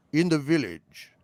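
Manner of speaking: cool, even British interrogator; British TV-villain reply; British accent. Acted. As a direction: answer calmly in a British accent. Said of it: "like in the opening of The Prisoner".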